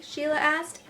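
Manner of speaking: ordinary delivery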